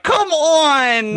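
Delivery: high, whiny mock begging; desperate pleading. Wingman begging his reluctant friend, desperate whine. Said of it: "look desperate in front of your friend".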